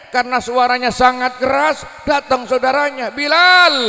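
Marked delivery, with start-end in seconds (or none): 3.2-3.8 higher, louder urgent call. Acted. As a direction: call his name much louder and higher. Relative rushes in calling.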